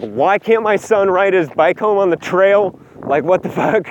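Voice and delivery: mock outrage without raising the volume; retold yelling. Mom getting out of the car at the cop, mild mock yell. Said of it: "starts yelling at the partner".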